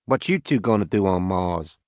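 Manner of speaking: lightly voiced Southern farmer; southern accent. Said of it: "He had a heavy southern accent".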